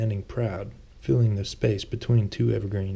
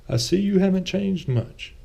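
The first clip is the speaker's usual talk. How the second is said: Thomas; 10 semitones higher, 4 dB louder than usual. A narrator's reading. Thomas teases Lucian.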